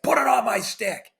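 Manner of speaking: high, forceful mock yell; snapped demand. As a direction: imitate a teammate yelling an order. Acting out teammate's angry demand at practice.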